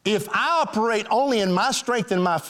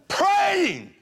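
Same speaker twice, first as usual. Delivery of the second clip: sudden high shout of the one word; shouted answer; 11 semitones higher than usual. Real, not acted. Preacher answering his congregation's question, shouting it out. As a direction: belt it out like the punchline of the sermon.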